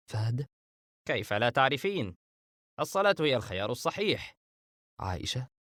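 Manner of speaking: ordinary delivery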